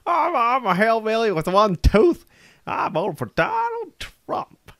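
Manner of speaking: high, silly mock hillbilly accent; failed hillbilly impression. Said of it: "I can't even be a hillbilly if I tried".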